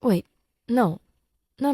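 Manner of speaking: ordinary delivery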